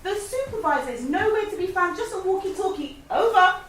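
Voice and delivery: high radio report; walkie-talkie voice. Pretend. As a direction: play-act a radio call. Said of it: "pretend you are speaking to the safety officer".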